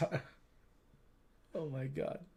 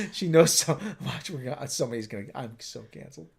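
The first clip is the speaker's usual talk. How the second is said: ordinary talk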